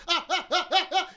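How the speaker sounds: high, loud mock cries of pain; retold pained cries. Retelling the friend's cries in the arm bar. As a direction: yelp like the arm's being wrenched. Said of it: "he just goes".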